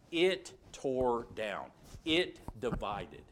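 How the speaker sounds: slow, weighty sermon close; slow solemn pulpit conclusion; solemn emphasis. Real, not acted. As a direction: let each word land heavily. Sermon climax.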